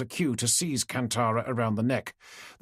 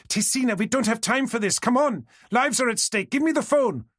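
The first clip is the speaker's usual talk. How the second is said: urgent commanding; 10 semitones higher, 3 dB louder than usual. A narrator's reading. Kantara urging sobbing Tesina.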